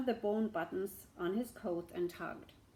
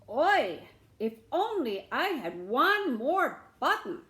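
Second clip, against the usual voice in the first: much louder theatrical lament; loud hint; 5 semitones higher, 9 dB louder than usual. Acted. Beggar's third hint to the shamus, loud lament. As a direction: wail it so he can't ignore it.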